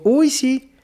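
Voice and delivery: high mock-sarcastic Uy, sí; joking sarcasm. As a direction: tease him with a high sarcastic Uy. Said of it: "Y le digo".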